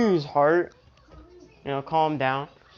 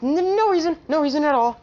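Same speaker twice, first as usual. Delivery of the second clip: much higher, louder flustered denial; 9 semitones higher, 4 dB louder, faster than usual. Acted. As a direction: blurt it out, caught red-handed. Mina flustered denying.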